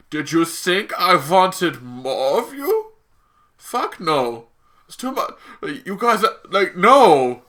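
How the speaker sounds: put-on comic Eastern European accent, indignant; mock Eastern European villain accent. Baron Zemo's villain speech acted out.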